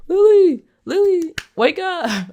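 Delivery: frantic calling, mock drama; mock desperate shout. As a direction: yell at her to wake, overplayed. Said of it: "he just, like".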